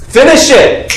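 high, loud call to the crowd; rally them to finish the line; urging shout. Real, not acted. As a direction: push the congregation to answer. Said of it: "shout it out".

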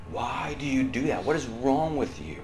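soft, restrained reproach; hushed dismay. Acted. Preacher challenging one breaking marriage covenant, hushed. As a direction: ask it quietly, letting it sink in.

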